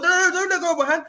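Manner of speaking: high, rapid mock-panicked babble; panicked plea. Novice pleading with biker holding his hand, mock panic. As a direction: beg frantically, half laughing.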